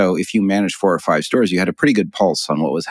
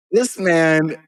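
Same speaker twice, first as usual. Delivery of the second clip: laughing; 10 semitones higher, slower than usual. Real, not acted. Punchline through laughter.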